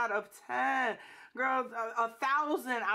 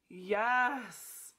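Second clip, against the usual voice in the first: ordinary talk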